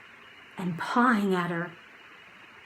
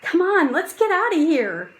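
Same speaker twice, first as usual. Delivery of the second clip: higher and louder, pressing to leave; urgent boy voice; 9 semitones higher, 6 dB louder than usual. Acted. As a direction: hurry her along, urgent.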